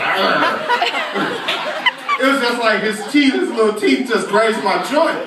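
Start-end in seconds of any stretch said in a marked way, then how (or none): 0.0-1.5 short "argh" of savage biting; mock gnawing growl. Teacher showing what the bite wasn't, mock growl. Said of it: "It wasn't like".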